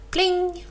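high ringing bell imitation; bell pling. Narrator imitating the cow's bicycle bell, high and ringing. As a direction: chime it out like a little bell.

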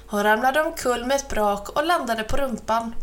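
plain reading voice

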